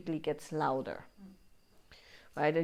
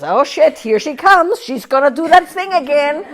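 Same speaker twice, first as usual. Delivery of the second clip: much higher, much louder panicked cry; a body dreading the next yoga class; 8 semitones higher, 15 dB louder than usual. Retold or imitated. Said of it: "The body essentially goes".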